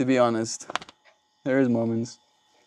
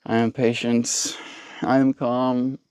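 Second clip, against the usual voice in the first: normal talking voice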